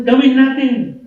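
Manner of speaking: high, loud prompt to repeat; rallying call. Shown as a demonstration. Preacher coaxes the shy congregation to say it, rallying call. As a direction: show a shy crowd how to shout it out. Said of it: "sabihin ng".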